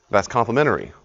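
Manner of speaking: ordinary talk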